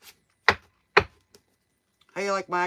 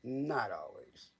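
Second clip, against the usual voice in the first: Not always dropped low and much softer; soft echo; 8 semitones lower, 10 dB quieter, slower than usual. Real, not acted. Streamer echoing own point to viewers, soft and low. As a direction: let Not always fade to a near-murmur.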